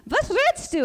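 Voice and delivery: high, loud burst of disbelief in Yiddish; startled outburst. Retold or imitated. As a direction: blurt out 'what are you saying?' in shock. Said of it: "She's like".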